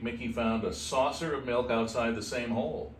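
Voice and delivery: ordinary delivery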